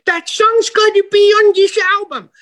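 very high, loud New York manager voice; manager's high exclamation. Retold or imitated. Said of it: "he said".

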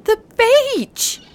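high, drawn-out howl; dog-like cry of sudden realisation. A narrator's reading. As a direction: howl it as it suddenly dawns on you. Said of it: "he howled in sudden realisation".

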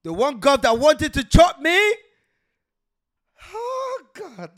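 loud, slow, then high cry; excited outburst. Real, not acted. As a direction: burst out in disbelief. Excited outburst about gov.

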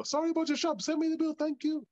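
put-on very high, sing-song Hong Kong accent; imitating Jackie Chan with a Hong Kong accent; Jackie Chan impression. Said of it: "Jackie Chan".

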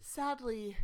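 usual speaking voice

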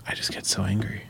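hushed confession; whisper. Real, not acted. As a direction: whisper it under your breath. Confessing road rage.

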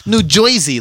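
put-on loud, high caricature of a Jersey accent; mock Jersey accent. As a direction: overdo "New Jersey" like an outsider would. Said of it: "everyone's bad at Jersey and New York".

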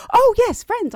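high, loud burst of recognition; light up at a name you know; excited recognition. Retold or imitated. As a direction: enthusiastic imitation of his reply. Acts out his delighted recognition.

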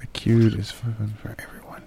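hushed whisper, very soft; whispered echo. Acted. Repeating the review title, whispered. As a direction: whisper it under your breath.

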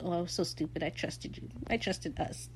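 soft, wounded near-whisper; imitated hurt. Ed tells his ex he trusted him, hurt. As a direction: voice a betrayed lover, hushed. Voicing sad, pathetic Ed.

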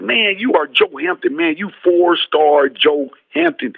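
high, slow hyping chant; team hype. Retold or imitated. Teammates hyping up Joe after the fight, high and slow. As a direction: pump him up, stretch the name out.

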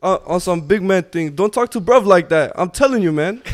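mock English accent. Giving friends a whole sentence, hammed-up English street accent. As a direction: play an English roadman squaring up, hammed-up accent. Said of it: "Speaking of the English accents".

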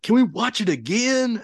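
high, loud imitated child's plea; eager kid. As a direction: beg excitedly like a kid. Retelling son's eager request.

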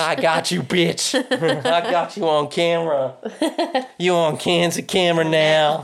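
high laughing taunt; mock triumph, cracking up; gloating. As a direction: friend mimics Rod catching Rose on camera, laughing.

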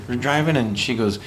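normal talking voice

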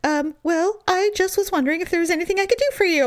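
high, hurried, hesitant mimicry; meek husband. Husband asking if he can help, meek high voice. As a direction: imitate someone timidly offering help, high and fast. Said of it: "He's like".

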